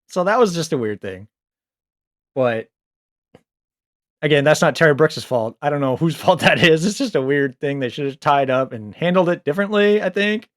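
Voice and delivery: ordinary delivery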